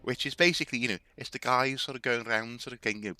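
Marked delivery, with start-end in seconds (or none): none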